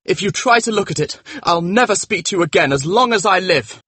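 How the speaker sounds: high, tense warning without shouting; enraged threat. A narrator's reading. Dorian keeping his friend from the portrait, with contained rage. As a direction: threaten him with pitch held high. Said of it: "pale and trembling with rage".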